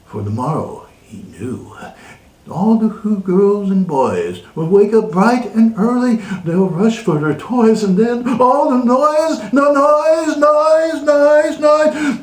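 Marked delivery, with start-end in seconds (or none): 2.5-12.2 higher and louder, building to a shout; building rant. A narrator's reading. Reader raging as the Grinch hates the noise. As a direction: rant ever bigger about the noise.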